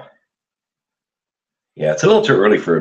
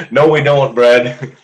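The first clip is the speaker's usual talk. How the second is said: laughing; 5 semitones higher, 7 dB louder, slower than usual. Real, not acted. Answering Brad's chat joke, laughing out loud. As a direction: answer while still laughing, a bit louder.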